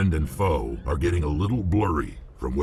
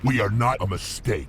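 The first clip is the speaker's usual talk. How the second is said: sharp, much higher, wounded retort; offended anger; 8 semitones higher than usual. Acted. Krogan hits back at the captain, offended and angry. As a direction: bristle at the insult to his people. Krogan rejects being called mistake.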